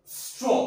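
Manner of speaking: teacher modelling "स्ट्रॉ" for pupils to repeat; modelled reading. Shown as a demonstration. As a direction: hold sss, then lift into ट्रॉ for "स्ट्रॉ". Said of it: "दोबारा सुनिएगा".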